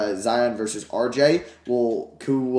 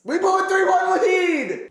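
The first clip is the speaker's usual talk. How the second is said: much higher and louder, slow furious yell; angry shout; 4 dB louder, slower than usual. Real, not acted. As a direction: yell it at the screen in frustration. Shouts lost lead after quiet take.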